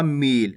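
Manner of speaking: मील, its vowel held long; drawn-out vowel. Shown as a demonstration. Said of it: "अगर खींच के पढ़ोगे".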